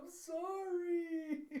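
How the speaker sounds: put-on very high, drawn-out childlike wail; tearful wail. Little girl apologizes to her scolding father, tearful wail. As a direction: bawl it out loud, stretching the last word. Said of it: "the whole crying eyes and starts trembling".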